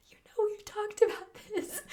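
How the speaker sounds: high-pitched retold refusal through laughter; laughing protest. Retelling how they turned down a hug, giggly high protest. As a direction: protest gently, giggling as you go.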